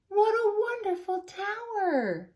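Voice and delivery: high, delighted exclamation; admiring. Acted. Grandfather praises On's tower, high and delighted.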